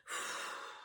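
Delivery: long breathy blow-out; empty the lungs with a big blow. Shown as a demonstration. Teacher blowing all the air out for the class. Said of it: "blow everything away".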